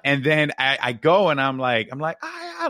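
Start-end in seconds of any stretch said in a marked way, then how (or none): 2.2-2.7 high, thin mock yell; high-pitched pretend holler to a brother; retold yell. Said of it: "like, yelling at him".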